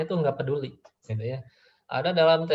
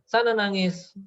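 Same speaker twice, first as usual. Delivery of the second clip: mock brush-off, pitched up; dismissive parent; 6 semitones higher, 3 dB louder, slower than usual. Parent sending a child off to cry, mock-dismissive. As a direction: imitate an uncaring parent, voice raised in pitch. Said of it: "Kita terlalu cuek gitu ya".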